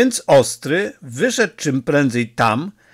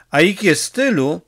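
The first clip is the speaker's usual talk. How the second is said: usual speaking voice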